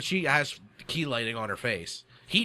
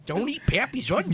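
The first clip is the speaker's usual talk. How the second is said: higher, hammed-up old-timer warning; old prospector; 8 semitones higher than usual. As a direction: croak a warning like a grizzled miner. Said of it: "give me an old prospector voice talking about onions".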